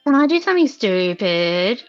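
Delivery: ordinary talk, nothing marked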